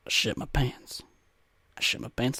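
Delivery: a hushed admission under the breath; whispered confession. Real, not acted. Confessing to the audience in a whisper.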